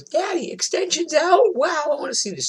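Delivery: put-on very high, squeaky excited kid voice; excited daughter. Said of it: "she goes".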